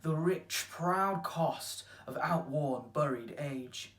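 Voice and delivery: slow, loud declaimed sonnet; poetry recital. Acted. Actor reciting a sonnet on time's ruin, declaimed. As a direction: declaim it in measured, weighty phrases.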